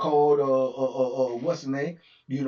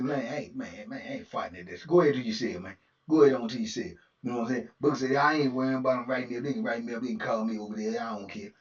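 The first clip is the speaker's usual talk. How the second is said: low dismissive Booker; 5 semitones lower, faster than usual. Retold or imitated. Booker brushing off the write-up, low and unbothered. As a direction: shrug it off low and cool.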